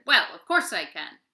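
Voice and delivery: loud proud giant voice; preening monster. Acted. Vinganani agreeing he is strongest, loud boastful voice. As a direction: show off like a vain giant.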